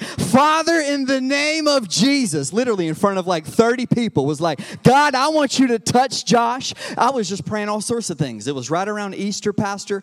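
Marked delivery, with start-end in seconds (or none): 0.0-6.7 louder, higher fervent prayer; retold fervent prayer. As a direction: pray aloud with fire. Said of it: "I was like".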